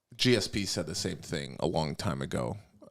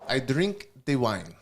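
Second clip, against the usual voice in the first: put-on GSP French-Canadian accent; 6 semitones higher, 4 dB louder than usual. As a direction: draw out the wine line in a mock French-Canadian accent. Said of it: "I gotta channel him a little bit".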